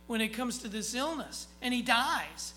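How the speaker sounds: loud, high, driving sermon climb; full-force preaching. Acted. Preacher rousing the congregation, loud full-force preaching.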